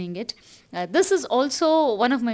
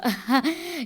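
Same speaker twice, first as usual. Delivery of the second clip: light laugh; 5 semitones higher than usual. Real, not acted. Laughs after her remark.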